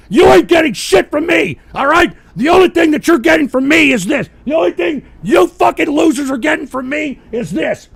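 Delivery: loud, high, raging yell; raging at grown men who live with their mothers; furious shout. Real, not acted. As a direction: bellow it at the freeloaders. Yelling at adult men living with mothers.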